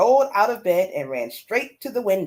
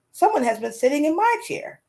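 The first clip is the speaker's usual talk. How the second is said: a little higher, lightly voiced mother bear; a touch brighter for Mama Bear; middle-sized Mama Bear voice; 7 semitones higher than usual. A narrator's reading. Storyteller voicing Mama Bear, lightly raised pitch. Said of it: "said in her middle-sized voice".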